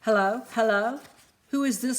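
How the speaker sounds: a mother anxious on the phone; frantic call. Acted. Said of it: "screamed Shannon".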